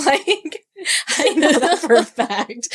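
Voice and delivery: real laughter through the words, high-pitched; laughing. Real, not acted. Retelling Lee's insult to a co-host, laughing helplessly. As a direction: crack up while delivering the line. Cracks up while retelling a quote.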